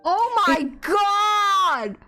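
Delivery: stunned gasp. Real, not acted. Streamer gasping at a blatant game confession, stunned. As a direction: let it hang in shock.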